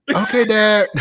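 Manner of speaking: father imitating his son answering; son's voice. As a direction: chirp like an obedient boy. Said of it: "I said".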